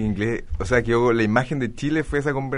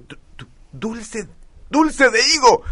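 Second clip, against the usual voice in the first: stammering, then much higher and louder; stammering lover; 11 semitones higher, 4 dB louder, slower than usual. Retold or imitated. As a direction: stumble on dulce, then burst it out high. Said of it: "el tipo entra y dice".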